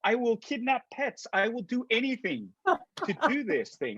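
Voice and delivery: high, excited retold yes; eager acceptance. Actor answering Lucasfilm's availability call, over-eager. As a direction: gush it like a fan who'll do anything.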